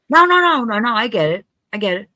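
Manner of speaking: high-pitched hurried reassurance; hasty bluffing. Retold or imitated. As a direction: insist you follow, pitched well up.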